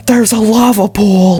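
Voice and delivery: high, loud sudden cry of delight; excited shout. Real, not acted. Gamer spotting lava, bursting out excited. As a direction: blurt it out thrilled. Spots lava mid-sentence.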